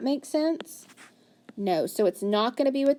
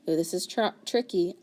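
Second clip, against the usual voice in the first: usual speaking voice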